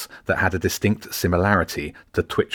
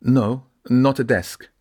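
slow, higher, fairly assured; more confident; 6 semitones higher than usual. A narrator's reading. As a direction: correct the caller with mild confidence. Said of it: "Elf sounded more confident now".

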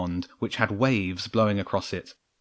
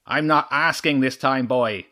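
vixen shouting over a gale, pitched up; shouting over wind; 3 semitones higher than usual. A narrator's reading. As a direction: call it out over the howling wind. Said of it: "Chimera shouted over the wind".

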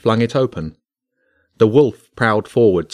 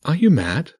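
ordinary delivery, nothing marked

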